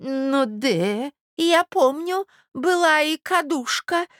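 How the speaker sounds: high, drawn-out vague murmur; absent-minded old prince. A narrator's reading. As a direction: ramble unconsciously as a doddering old man. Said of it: "пробормотал бессознательно князь". (старый князь, бессознательно бормочет)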